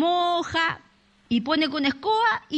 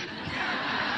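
laugh; 6 dB quieter than usual. Real, not acted. Audience laughs at the punchline.

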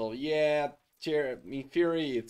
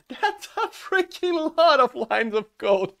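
higher, louder, laughing out the words; cracking up at the absurd number; real laughter; 8 semitones higher, 5 dB louder than usual. Real, not acted. Laughing at huge line count.

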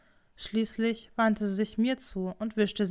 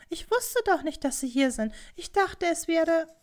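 high, flustered protest; worried, breaking off mid-excuse; anxious excuse; 8 semitones higher than usual. Acted. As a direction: defend yourself timidly, pitched up. Servant girl excuses herself, cornered.

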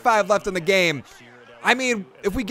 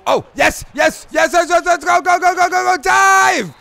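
high, loud, rising cheers building to a shout; excited yelling; 9 semitones higher, 5 dB louder than usual. Real, not acted. Gamer yelling at his player to dive, in loud excited cheers. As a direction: urge the runner on at the top of your voice.

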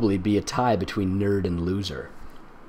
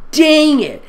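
high, loud mock yelp; mock frustrated cry; 10 dB louder than usual. Girl fumbling at the lock, high loud mock yelp. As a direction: yelp it like a cartoon teen.

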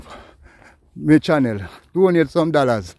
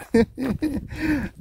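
high laugh; 10 semitones higher, 5 dB quieter than usual. Real, not acted. Laughs at his own claim.

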